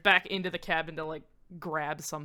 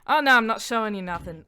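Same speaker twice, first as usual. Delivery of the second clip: louder, higher Australian character voice; Australian accent; 5 semitones higher, 8 dB louder than usual. Acted. Mania refusing to show Bara anything, Australian accent. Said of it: "that she turned Australian".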